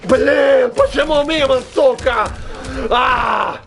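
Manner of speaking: loud high furious yell; angry shout. Real, not acted. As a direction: scream at the missed hit. Missed attack rage. (громкий злой крик)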